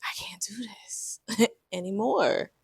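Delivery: a broken whisper with a little laugh inside; whispered plea. Retold or imitated. Wife telling husband she can't go on, whispered. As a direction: barely get the words out, whispered.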